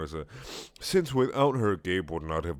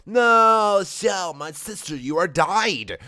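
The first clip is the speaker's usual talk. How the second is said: high, loud mock wail; hammed-up anguished cry, drawn out at the end; crying out in grief; 11 semitones higher, 11 dB louder, much slower than usual. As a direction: wail over a dying sister. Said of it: "I cried so much".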